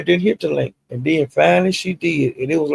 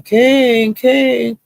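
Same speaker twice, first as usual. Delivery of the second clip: mock call, much higher and louder; retold hollering; 9 semitones higher, 4 dB louder than usual. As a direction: holler the name up high like calling a kid. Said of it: "she was hollering".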